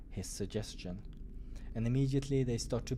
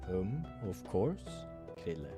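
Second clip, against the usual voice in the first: soft low reply; 3 semitones lower, 4 dB quieter than usual. Acted. Doctor answers softly.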